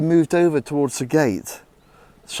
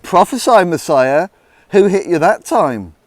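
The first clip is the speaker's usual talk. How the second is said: loud, high mocking taunt; mock him while striking; jeering; 4 semitones higher, 9 dB louder than usual. Guards taunting the beaten Jesus, loud jeering. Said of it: "they jeered".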